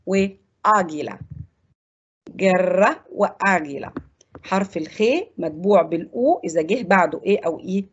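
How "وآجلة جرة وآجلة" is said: slow "آجلة" with hard g, no u; drawn-out teacher's "آجلة", u silent; silent u demo. Shown as a demonstration. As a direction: model "جرة" and "آجلة" slowly, hard g. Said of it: "لا ينطق وتز".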